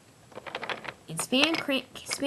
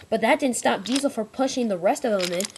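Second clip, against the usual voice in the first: usual speaking voice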